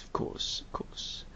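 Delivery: breathy whispered aside. Real, not acted. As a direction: mutter it under your breath. Muttered aside agreement.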